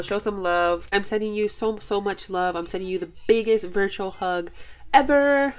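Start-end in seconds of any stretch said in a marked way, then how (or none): 4.9-5.5 louder, higher "ever" to finish; emphatic ever. Real, not acted. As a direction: punch "ever" with joy. Sending a big virtual hug.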